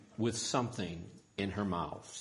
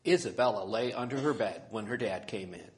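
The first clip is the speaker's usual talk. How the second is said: usual speaking voice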